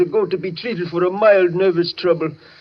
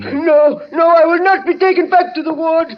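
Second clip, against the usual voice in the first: desperate pleading; 10 semitones higher than usual. Acted. Patient begs the couple as the orderly drags him off, frantic. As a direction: panic as he is hauled away. Patient dragged back to ward.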